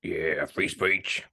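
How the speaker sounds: a mock creep pretending to back the cause; creepy pervert. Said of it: "why they're speaking like that in New York".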